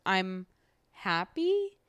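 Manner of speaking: "happy" jumps sharply up like a question; unsure question. Retold or imitated. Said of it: "definitely a question mark at the end of that sentence".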